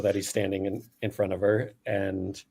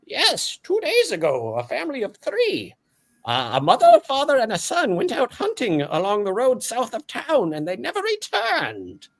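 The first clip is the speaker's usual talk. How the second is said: very high, fast, formal monster voice; a high, prim floating orb speaking; orb creature voice; 13 semitones higher than usual. Acted. GM as Gregory the orb, high and formal. Gregory reports missing family.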